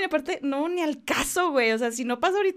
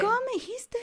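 high, sharp mock demand; imitating the partner's suspicious question; 13 semitones higher, faster than usual. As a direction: snap the question like a suspicious girlfriend. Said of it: "y me dice como".

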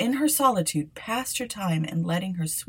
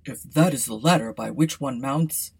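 king's son; 3 semitones lower, 4 dB louder than usual. A narrator's reading. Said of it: "he said".

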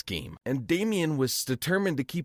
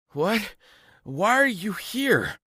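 higher, slower, amazed question; surprised; 6 semitones higher, slower than usual. Acted. Narrator surprised to see his friends, higher and slower. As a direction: sound caught off guard and puzzled.

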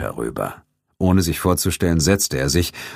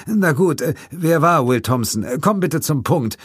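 impatient; 10 semitones higher than usual. A narrator's reading. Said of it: "forderte Corden ungeduldig".

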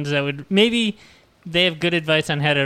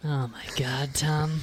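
whispered; 8 dB quieter than usual. Real, not acted. Embarrassed at being teased.

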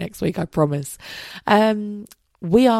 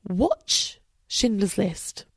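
ordinary talk, nothing marked

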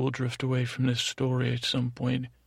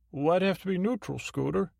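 higher, quicker put-on voice; high-pitched, touchy co-worker; sidekick taking issue; 6 semitones higher than usual. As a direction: question the host in a high sidekick voice. Voices Bob challenging the host.

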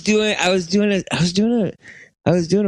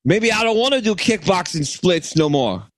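hammed-up Chicago accent. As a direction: put on a loose Chicago pastiche. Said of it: "Chicago guy".

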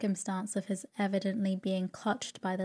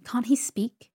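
impatient, exasperated question; running out of patience; 3 semitones higher, 4 dB louder, slower than usual. A narrator's reading. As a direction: demand it with mild exasperation. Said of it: "cried my aunt impatiently".

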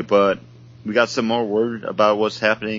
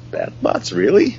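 whispered disbelief rising to a surprised shout; 3 semitones lower than usual. Retold or imitated. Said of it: "and I was like".

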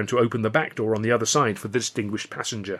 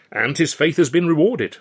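dry remark, higher and louder; droll and emphatic; dryly; 5 semitones higher, 5 dB louder than usual. A narrator's reading. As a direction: deliver it as a pointed, wry aside. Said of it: "Alf said dryly".

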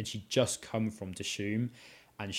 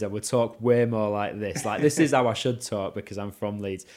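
a light Leeds accent, louder; 7 dB louder than usual. Shown as a demonstration. Guest demos her home Leeds accent for the host, louder. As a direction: show off a broad Leeds accent. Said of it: "if I spoke like I were from Leeds".

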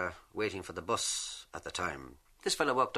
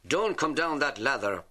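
higher, louder call up a ladder; shouting up; 6 semitones higher, 4 dB louder, slower than usual. Acted. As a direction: call up to a mate on the building site. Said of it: "one fella shouted up to the other".

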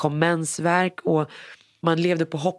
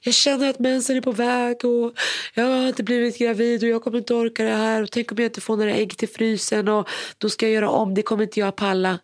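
higher, anxious tumbling worry about the period coming; tearful worry; 7 semitones higher than usual. Retold or imitated. Bride tells mother her fears at henna night, tearful and high. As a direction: pour out fears as if near tears. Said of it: "så började jag gråta".

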